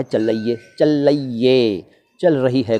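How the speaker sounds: चल रही है run together, rural UP style; rural UP pronunciation. Shown as a demonstration. Teacher demonstrates how UP villages say it, slurred. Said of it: "इस तरह से बोला जाता है".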